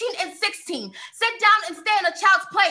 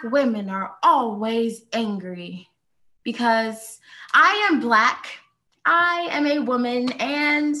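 slow, weighty phrases with pauses; slow, deliberate; 5 semitones lower, slower than usual. Acted. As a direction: hold back, spacing the words out slowly. Poet slows before her outburst.

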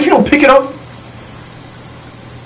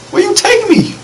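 excited. Real, not acted. NPC leading him the wrong way.

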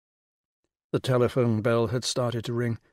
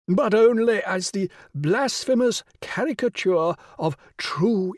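grand regal lady, pitched high and louder; rich royal voice; 7 semitones higher, 4 dB louder than usual. Acted. The Rani greeting Will on the phone, rich and regal. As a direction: sound like a queen condescending down the phone. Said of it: "dwelling emphatically".

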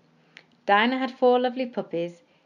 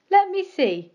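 concerned; 10 semitones higher, 6 dB louder, slower than usual. Acted.

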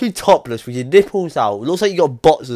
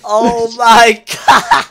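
laughing shout; 6 semitones higher, 6 dB louder, much slower than usual. Real, not acted. Laughing fit at the photo.

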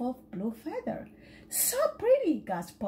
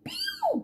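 high squeaky pew at the smell; disgusted pew. Acted. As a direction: wrinkle your nose at the pond. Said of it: "gasped Mommy".

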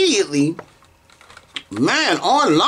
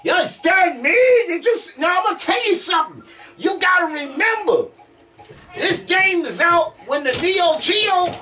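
high-pitched, insistent excitement; hyped-up commentary; 10 semitones higher than usual. Real, not acted. Player hyping an old fighting game, high excited commentary. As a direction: gush about it, voice pitched way up.

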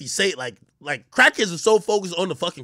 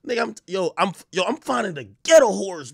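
usual speaking voice

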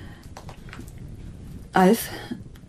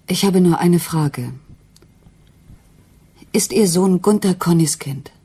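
raised, slow, pressing question; louder, deliberate confrontation; firm demand; 5 dB louder than usual. Acted. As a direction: press her hard for the truth. Wife confronts mother about husband.

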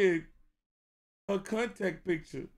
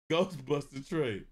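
usual speaking voice

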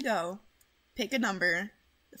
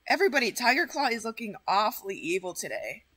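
ordinary talk, nothing marked

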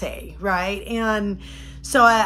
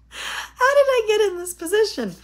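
high, louder half-crying lament; mock wail; 12 semitones higher, 4 dB louder than usual. Mom stranded in parking lot, high comic wail. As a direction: cry it out in comic despair. Distressed rhetorical outcry.

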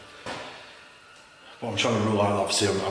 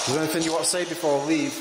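calling out to spirits; 7 semitones higher, faster than usual. Real, not acted. Asking spirits a question.

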